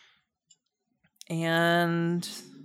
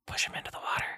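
unvoiced whisper in the ear; 11 dB quieter, faster than usual. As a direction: breathe it right into their ear. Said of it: "Oddnobs whispers into your ear".

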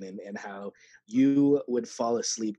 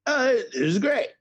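delighted; 10 semitones higher, 7 dB louder than usual. Retold or imitated. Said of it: "I'm like".

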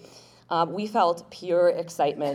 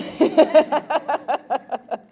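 a short, high burst of laughter; bright laugh; 12 semitones higher, 12 dB louder than usual. Real, not acted. Host laughing with the crowd, a high bright laugh. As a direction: laugh warmly at the honoree's bio.

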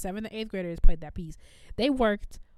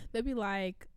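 ordinary talk, nothing marked

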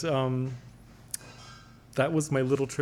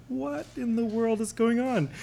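bewildered; 8 semitones higher than usual. Retold or imitated. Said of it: "And I was like".

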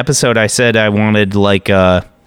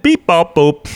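higher robotic beeps; toy robot voice; 6 semitones higher than usual. Pretend. Playing vending machine.

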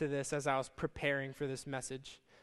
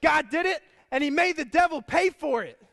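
high, loud, victorious retort; triumphant punchline; 10 semitones higher, 10 dB louder than usual. Retold or imitated. Lady turning the tables on the neighbor, loud and triumphant. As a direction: land the final word in triumph. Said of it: "she looks at him and says".

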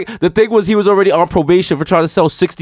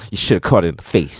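put-on low, softer old-timer voice; old head; 11 semitones lower, 4 dB quieter than usual. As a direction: imitate a low-voiced old dope fiend. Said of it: "this old head was like".

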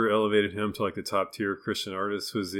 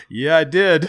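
smug Thomas Kincaid; 4 semitones higher, 12 dB louder than usual. Retold or imitated. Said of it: "Somewhere Thomas Kincaid says".

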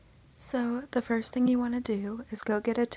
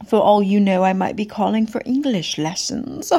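fake French accent; 10 dB louder than usual. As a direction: lay on a thick fake French accent, loud and proud. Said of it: "I have been working on my French accent".

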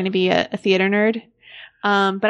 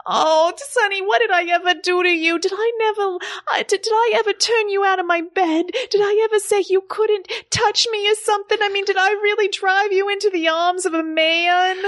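higher, shocked, fretful wife on the phone; Angie on the phone; 11 semitones higher than usual. Acted. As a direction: play the frantic wife who just saw him on TV.

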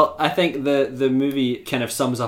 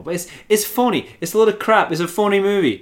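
heated rant; 9 semitones higher, 4 dB louder than usual. Real, not acted. Mock ranting about phoniness.

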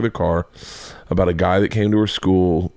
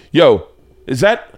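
suspicious challenge; 6 semitones higher, 6 dB louder, slower than usual. Retold or imitated. Comic retells challenging child's bit.

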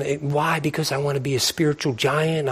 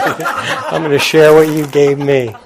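laughing; 6 dB louder, slower than usual. Real, not acted. Laughing while declaring share.

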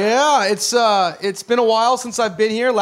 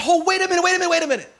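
higher, a father stepping in to stop it; firm father; 6 semitones higher, faster than usual. Retold or imitated. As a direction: call out like a father halting a fight. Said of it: "nobody stopped and said".

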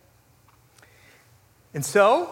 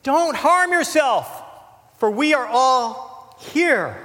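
high, slow, loud call into the dark; Paul shouting to the jailer, loud and urgent; loud cry; 7 semitones higher, 4 dB louder, slower than usual. Acted. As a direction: cry out to stop him. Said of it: "Paul cried with a loud voice".

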